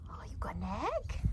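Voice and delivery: hushed whisper to the dog. Real, not acted. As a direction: whisper it softly.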